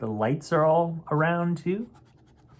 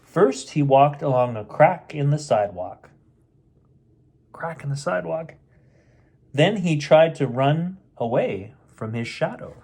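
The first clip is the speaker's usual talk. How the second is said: plain reading voice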